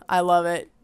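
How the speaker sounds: ordinary talk, nothing marked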